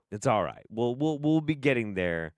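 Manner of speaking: ordinary talk, nothing marked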